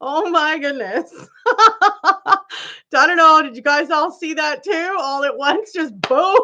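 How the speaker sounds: high voice broken by laughter; real laughing fit. Real, not acted. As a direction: talk through helpless giggles. Laughing fit at chat flooding.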